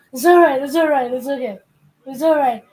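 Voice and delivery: high, repetitive mock reassurance; hammed-up hushing in short bursts; frantic self-soothing. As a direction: act out calming your inner voices. Demonstrating the bellboy's self-hushing act.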